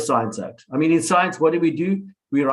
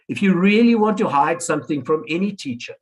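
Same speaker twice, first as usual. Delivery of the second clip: usual speaking voice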